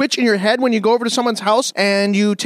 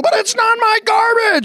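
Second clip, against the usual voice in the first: whiny outrage; 10 semitones higher than usual. Acted. Acted quarrel, neighbor upset.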